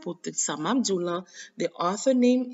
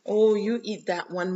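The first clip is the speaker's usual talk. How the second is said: ordinary talk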